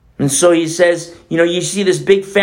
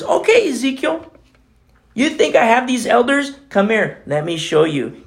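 the Lord teasing; 6 semitones higher than usual. Retold or imitated. The Lord answering Ezekiel.

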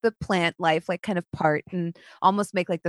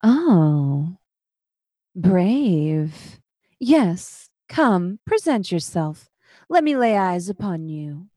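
drawn-out silky villainess welcome; 3 dB louder, much slower than usual. Acted. Spider lady calling from her green hollow, silky. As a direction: savor each word, silkily. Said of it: "in that same silky voice".

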